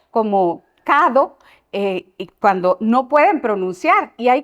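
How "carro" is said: "cado" with a D in place of the R; "cado" with a D sound, a child's slip. Shown as a demonstration. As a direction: say "cado" like a child who can't trill the R. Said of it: "la usan como D".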